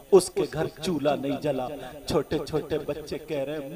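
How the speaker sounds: quiet sermon story; hushed sermon narration. A narrator's reading. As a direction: draw the crowd in quietly.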